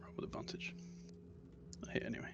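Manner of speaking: fast whispered aside; whispering. Real, not acted. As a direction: whisper it to yourself. Aside to table.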